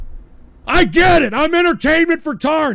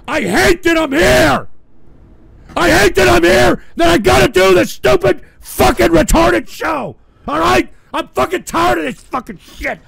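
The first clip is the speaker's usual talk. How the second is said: louder, higher, shouted outburst; furious shout; 6 semitones higher, 5 dB louder than usual. Real, not acted. Streamer hating that he has to do the show, furious shout. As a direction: let the rage boil over for real.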